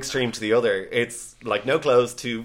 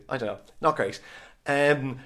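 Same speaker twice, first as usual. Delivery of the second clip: ordinary delivery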